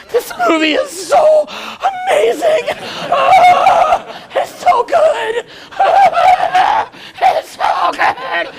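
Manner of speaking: very high, slow wail through sobs; blubbering falsetto breakdown; hammed-up sobbing. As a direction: bawl over a romantic movie. Mock sobbing woman watching the film.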